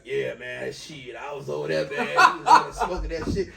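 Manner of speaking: loose, laughing street-talk imitation; off-the-phone casual. Friend mimics him chatting after the call, casual. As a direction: drop the phone voice, chat like buddies. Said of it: "And then get off the phone".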